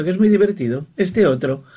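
normal talking voice

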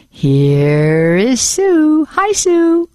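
drawn-out playful introduction rising to a high greeting; cheerful, a bit wacky. Real, not acted. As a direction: stretch it out, then greet her high and cheerily. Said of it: "You sound so nice and cheerful and a little bit wacky".

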